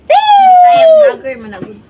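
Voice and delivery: high, slow hammed-up announcer call; announcer shout. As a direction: belt it like a show host. Announcing vlogger dramatically.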